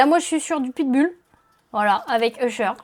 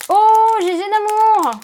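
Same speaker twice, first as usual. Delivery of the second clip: high, slow, loud delight; excited cry; 8 semitones higher, 8 dB louder, slower than usual. Real, not acted. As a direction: cry out with joy at the card. Excited at a good pull.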